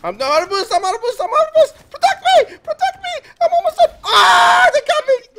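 very high, loud frantic yelling; panicked shouting. Real, not acted. Player begging teammate to protect him, frantic shouting. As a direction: shriek for help as you're being hunted down.

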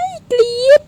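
high, loud delighted scream; fan scream. Real, not acted. Streamer announcing she is screaming at meeting her idol. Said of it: "ฉันกรี๊ดค่ะ".

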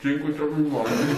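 muffled, barely open mouth; mouth full. Real, not acted. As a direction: speak around five marshmallows. Five marshmallows in mouth.

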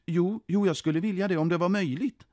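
hopeful applicant. A narrator's reading. Den som står framför kontrollanten, hopeful. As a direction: answer eagerly but politely.

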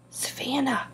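hushed, astonished whisper; breathed. A narrator's reading. Boy alerts classmate to the groveling dog, whispering. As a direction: barely breathe the name out. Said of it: "breathed Ben".

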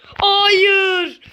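long, high, loud wail on Hayır; drawn-out high loud Hayır; anguished no. Real, not acted. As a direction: cry out Hayır in despair. Losing the round.

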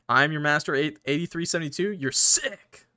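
normal talking voice

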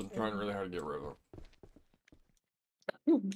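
holding back hiccups; fighting off hiccups mid-sentence; suppressing hiccups. Real, not acted. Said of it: "I have the hiccups".

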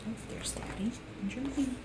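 usual speaking voice

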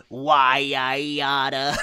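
slow, loud Why I oughta; old-timey tough guy. Pretend. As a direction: growl it like an old cartoon. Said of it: "You want me to go".

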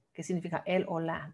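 normal talking voice

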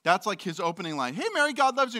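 high, loud friendly angel; cheery angel greeting. Retold or imitated. Angel greeting Mary, high and cheery. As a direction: greet her like a chipper messenger. Angel voiced in retold story.

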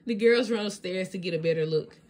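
usual speaking voice

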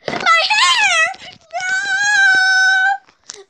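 high, loud cartoon shriek; horrified wail. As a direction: wail in melodramatic horror. Girl learns she lost her hair.

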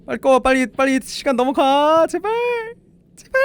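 high, urgent pleading; panicked pleading. Real, not acted. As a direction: beg desperately, stretching into a squeal. Begging the clock to run out.

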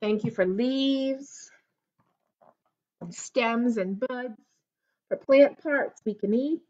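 storybook read-aloud; reading to children. Acted. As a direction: read it slowly to a little child. Reads picture book aloud.

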